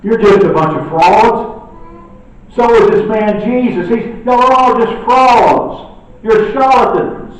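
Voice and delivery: loud, jeering accusation of fraud; mocking scribes. Scribes loudly mocking the disciples and Jesus as frauds. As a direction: sneer it out loud like an enemy scorning them. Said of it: "Hear the scribes".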